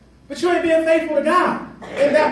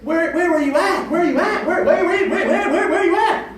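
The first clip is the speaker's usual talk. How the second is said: calling out; 5 semitones higher than usual. Acted. Calling out, laughing, rising.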